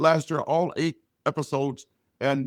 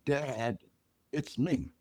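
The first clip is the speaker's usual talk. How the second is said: soft reveal; 2 semitones lower, 10 dB quieter, much slower than usual. Retold or imitated. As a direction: drop to a gentle, hesitant reveal. Daughter reveals herself at door.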